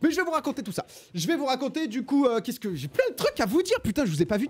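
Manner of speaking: words spilling out, overexcited; too much caffeine. Real, not acted. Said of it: "je suis surexcité".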